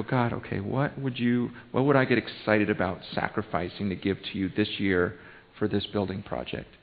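soft, low, private prayer to God; quiet prayer. Shown as a demonstration. Pastor modelling silent prayer for the congregation, soft and low. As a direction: murmur it as if alone with God. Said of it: "just between you and God, to go".

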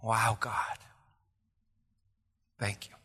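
whispered, hushed thanks; awed whisper. Retold or imitated. Preacher voicing grateful wonder to God, whispering. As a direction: breathe it out in wonder. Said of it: "every day is like".

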